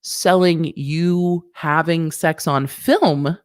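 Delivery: usual speaking voice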